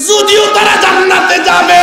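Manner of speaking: high, loud proclamation; full-force preaching. Real, not acted. Preacher proclaiming the Prophet's warning about paradise, high shout. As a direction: burst out high to the gathering.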